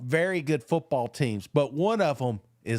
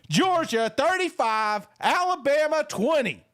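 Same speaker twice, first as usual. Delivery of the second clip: excited prediction; 10 semitones higher than usual. Real, not acted. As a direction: announce the score like a bold sure thing. Bold score prediction.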